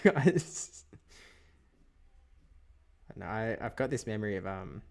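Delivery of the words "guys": thick Australian accent. Shown as a demonstration. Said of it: "that's what this word sounds like".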